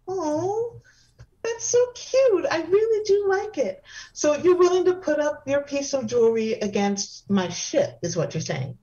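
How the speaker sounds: high, airy, dainty hostess; delicate femme voice. Acted. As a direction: gush sweetly like a dainty socialite. Same dainty character.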